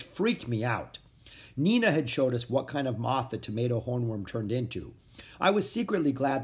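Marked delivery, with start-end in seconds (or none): none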